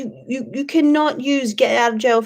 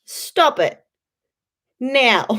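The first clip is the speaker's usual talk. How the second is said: retold snap; 2 semitones higher, 4 dB louder, much slower than usual. Turning round in class to silence a chatty girl, slow loud snap. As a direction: bark it at the chatty schoolgirl, slowly. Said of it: "turn and be like".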